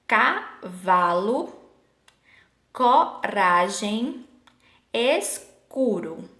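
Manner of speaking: slow, split "cavalo, coragem, escuro", each C a K sound; teacher sounding out the K in "cavalo, coragem, escuro"; hard C demo. Shown as a demonstration. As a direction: stretch "cavalo, coragem, escuro" syllable by syllable to show the K. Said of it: "vai ter o som de K".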